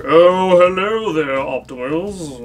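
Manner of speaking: slow, put-on villain voice drawing out Optimus; hammed-up sly villain voice; mock villain greeting. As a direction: purr it like a smug cartoon villain. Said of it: "He's like".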